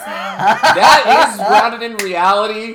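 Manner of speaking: laughing. Real, not acted. Laughing retort.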